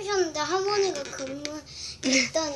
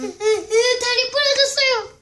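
high, slow whine; whiny complaint; 9 semitones higher, 11 dB louder, slower than usual. Pretend. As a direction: whimper like a hurt kid. Patient whines about leg.

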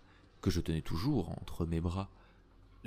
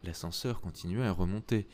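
usual speaking voice

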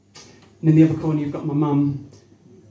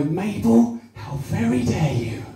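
put-on posh old neighbour; slower than usual. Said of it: "someone go".

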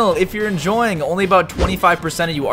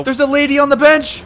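high-pitched exclamation of disbelief; incredulous outburst; 8 semitones higher than usual. Real, not acted. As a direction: blurt it out high at an unexpected obstacle. Sudden obstacle on the course.

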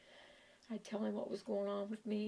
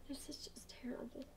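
whisper; 4 semitones higher, 12 dB quieter than usual. Real, not acted. Grieving mother breaks into whisper.

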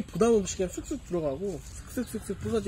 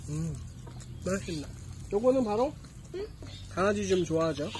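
chewing while talking; speaking between bites; mouth full; 4 dB quieter than usual. Real, not acted. Seen 1: man bites food at -2, talks with food in gloved hand.

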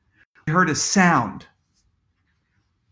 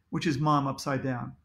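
ordinary delivery

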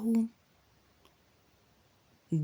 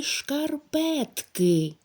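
шкарпетки drawn out slowly, each syllable шкар-пет-ки pulled apart; 6 semitones higher, much slower than usual. Shown as a demonstration. Teacher sounding out шкарпетки slowly for pupils to count syllables. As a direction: stretch шкарпетки syllable by syllable so pupils hear the parts. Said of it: "у якому складі ми чуємо букву".

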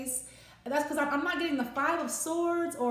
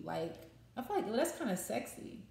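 ordinary delivery

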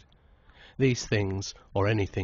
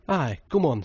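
eager agreement; 6 semitones higher, 5 dB louder than usual. A narrator's reading.

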